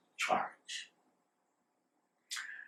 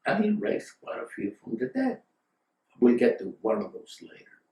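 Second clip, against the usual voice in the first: ordinary delivery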